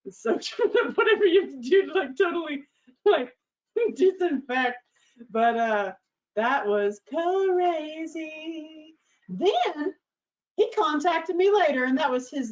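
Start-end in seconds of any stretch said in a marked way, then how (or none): 0.1-4.8 high, giggly, breaking into laughter; laughing through words. Real, not acted. Laughing while describing the cleanup. 7.1-8.9 "crazy" stretched very long and high; drawn-out emphasis. Real, not acted. Comic verdict on the story.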